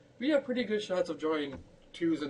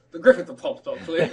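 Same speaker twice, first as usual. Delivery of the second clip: mock sarcastic; 3 semitones higher, 10 dB louder than usual. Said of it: "I was like".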